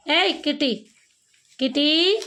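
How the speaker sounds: high, drawn-out calling of the cat's name; coaxing cat call. Real, not acted. Woman coaxing the stray cat to come closer, high sing-song call.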